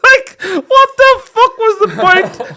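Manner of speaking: squeals of laughter through high, loud words; can barely get it out for laughing; real laughter breaking up the words; laughing while talking. Real, not acted. Cracking up at his own story.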